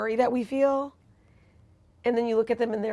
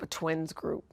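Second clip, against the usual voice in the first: ordinary delivery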